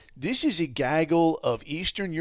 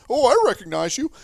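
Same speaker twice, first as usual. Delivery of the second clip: higher, louder sing-song mock greeting; fake-cheerful "oh, hi" in a sarcastic put-on voice; mock friendly greeting; 9 semitones higher, 6 dB louder than usual. Mocking Truman's quick recognition.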